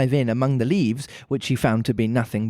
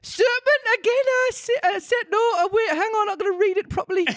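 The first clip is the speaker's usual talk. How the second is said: laughing pigeon voice. Real, not acted. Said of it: "I got myself carried away".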